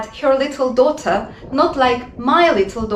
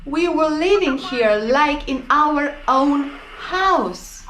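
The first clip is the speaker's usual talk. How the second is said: higher, slowed, whiny mock complaint; mocking whiny occupant; 6 semitones higher than usual. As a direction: whine like a spoiled woman forced to leave. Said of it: "She is crying".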